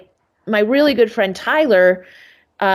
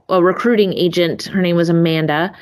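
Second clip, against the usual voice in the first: ordinary delivery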